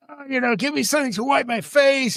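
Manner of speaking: a hint of a drunk man whining; drunk guy voice. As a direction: lightly play the sweaty drunk at the bar. Drunk sweaty Spanish guy quoted.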